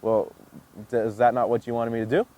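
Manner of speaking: deflated, uncertain question; confused dog. Acted. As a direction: voice an unsure dog, dropped low and halting. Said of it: "they slow down and they're confused".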